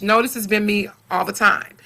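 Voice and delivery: usual speaking voice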